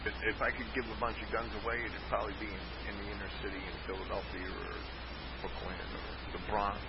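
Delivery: distant, muffled voice off mic; sounding like the next room over; from another room. Real, not acted. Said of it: "you sound like you're in the next room".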